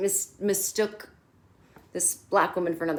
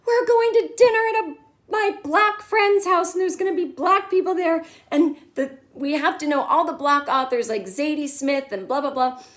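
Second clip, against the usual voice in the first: high, loud panicked rush; frantic Charlotte; 7 semitones higher, 3 dB louder than usual. Retold or imitated. Charlotte freaking out at Harry about the dinner, high and loud. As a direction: fret over the dinner.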